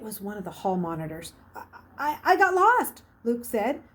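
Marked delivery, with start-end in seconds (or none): 1.6-3.0 put-on stammering boy, pitch jumping up on lost; flustered stammer. A narrator's reading. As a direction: stumble out a nervous excuse. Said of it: "not stammering any more than".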